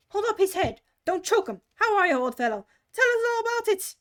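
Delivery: loud eager voices; excited crowd. Acted. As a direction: crowd round him, calling out. Crowd fussing over Bill.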